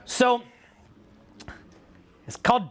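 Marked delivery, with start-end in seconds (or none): none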